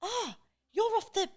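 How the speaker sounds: imitating Tom's dad. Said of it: "Tom's dad went".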